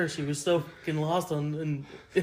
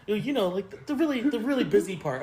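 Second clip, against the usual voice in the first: higher, louder playful interrogation; mock quizzing; 8 semitones higher, 3 dB louder than usual. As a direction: set a trap with fake interest. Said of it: "I would ask you questions".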